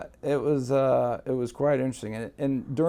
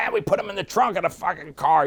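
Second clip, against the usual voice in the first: loud mobster; 7 semitones higher, 5 dB louder than usual. Retold or imitated. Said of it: "Jerry was really loud".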